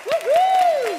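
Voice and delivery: high whooping cheer; excited cheer. Real, not acted. Cheering the children.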